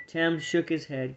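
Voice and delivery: ordinary delivery, nothing marked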